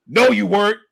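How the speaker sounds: imitated firm contradiction; officer's sharp rebuttal. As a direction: cut the excuse down loudly. Acted officer contradicting the driver.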